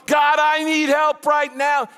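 high, drawn-out shouted plea to God; desperate cry. Acted. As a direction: cry out frantically for help. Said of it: "he is crying out, probably shouting out".

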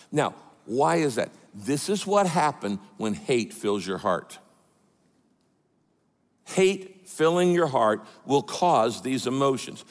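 usual speaking voice

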